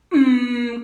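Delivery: loud hum pushed from the abdomen; punched-belly hum. Shown as a demonstration. Coach showing the right exercise, forceful belly-driven hum. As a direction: hum as if hit in the stomach. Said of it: "como si te dieran un golpe en el abdomen".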